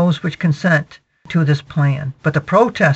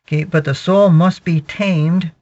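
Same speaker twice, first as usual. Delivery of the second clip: ordinary talk, nothing marked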